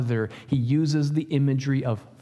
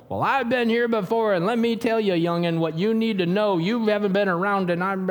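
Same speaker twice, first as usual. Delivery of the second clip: mock condescending old-timer, pitched higher; smug older man; 6 semitones higher than usual. Pastor mocks a know-it-all elder lecturing youngsters, higher pitched.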